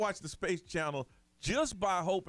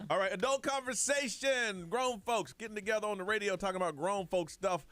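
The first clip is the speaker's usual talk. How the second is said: radio announcer; 4 semitones higher, slower than usual. Acted. Radio show announcer segue.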